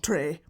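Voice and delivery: "Three" said as "tree"; Irish pronunciation. Shown as a demonstration. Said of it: "Ask me how to say the number three".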